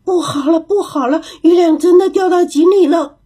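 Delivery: high, alarmed cry of many monkeys; 猴子们一起惊叫; startled cry. A narrator's reading. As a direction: cry out in shock together. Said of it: "一起惊叫起来".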